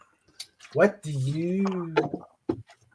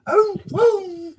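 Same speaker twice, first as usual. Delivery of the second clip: high hooting whistle call; train whistle; 4 dB louder than usual. Pretend. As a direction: hoot it like a steam train. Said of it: "his best of a impression of a train whistle".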